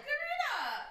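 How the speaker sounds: very high, squealed through laughter; laughing protest. Real, not acted. Scolding Karina for her Willy Wonka line, squealing laughter. Scolding friend while cracking up.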